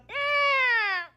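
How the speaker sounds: high squeaky rubber-chicken squawk; mock moan. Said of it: "Every time Marshall moaned".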